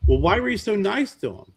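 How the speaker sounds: put-on a child who can't work out her father; daughter's voice. Daughter questions him after the elevator.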